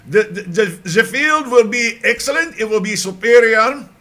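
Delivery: loud, high mock German accent, laughing through it. Boasting the German field will be perfect, in a hammed-up German accent. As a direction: ham up a stern German promise in a German accent. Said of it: "I shouldn't say superior with a German accent".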